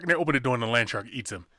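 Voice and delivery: normal talking voice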